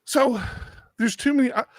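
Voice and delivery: ordinary talk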